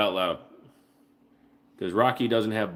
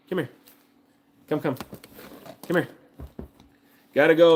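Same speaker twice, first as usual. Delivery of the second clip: ordinary delivery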